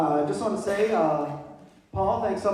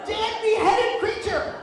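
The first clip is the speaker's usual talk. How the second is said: high, incredulous mock outrage; mock disbelief; 12 semitones higher than usual. Telling about the deer-head wallpaper, high mock disbelief. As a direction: act stunned by the photo.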